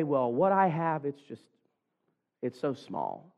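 soft, low, dejected excuse; acted self-doubt. Preacher voices a doubting listener's excuse, soft and dejected. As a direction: play a doubter, voice dropping away softly.

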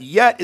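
"yet" said loud and high on its own; stress on yet. Shown as a demonstration. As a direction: hit "yet" hard and alone. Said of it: "a conjunction that we don't".

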